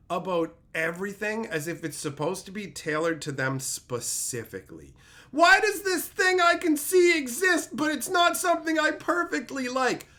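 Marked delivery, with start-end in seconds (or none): 5.3-10.1 high, loud whining complaint; whiny mock viewer. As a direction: whine like a spoiled viewer.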